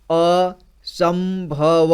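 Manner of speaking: असंभव stretched very slowly, each syllable apart. Shown as a demonstration. Teacher demonstrating how to read long words, very slow syllable by syllable. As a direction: spell out असंभव slowly so a child can follow. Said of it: "एक एक जोड़ करके इसी तरह से पढ़ना है".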